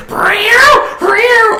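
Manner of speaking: high cat meows. Retold or imitated. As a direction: mimic a toy cat mewing high. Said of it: "I hear".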